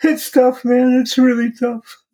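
high, strained voice close to crying; barely holding it together; voice breaking. Real, not acted. Father admitting to the host how hard it is, voice breaking. Father choked up after host's remark.

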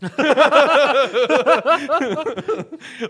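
cracking up hard, loud and high; howling laugh at the funny scene; loud high burst of laughter. Real, not acted. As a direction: let a big real laugh burst out. Laughing at anime reaction.

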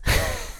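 short, quiet chuckle; amused little laugh; small laugh. Real, not acted. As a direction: chuckle briefly to yourself. Amused at own kids comparison.

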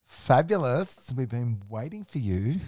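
loud, high camp drawn-out "fabulous"; put-on delighted, theatrical welcome; camp greeting. Host in underpants greets the roofers, hammed-up camp delight.

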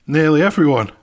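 loud, high exasperated answer. Real, not acted. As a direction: blurt it out, fed up. Exasperated repeat in argument.

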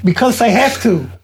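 sweet voice for daughter. Real, not acted. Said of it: "Why are you talking like that?".

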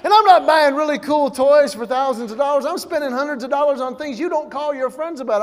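normal talking voice

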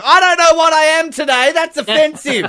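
a shrill caricature of a touchy passenger; mocking an easily offended commuter on the escalator. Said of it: "somebody ... going".

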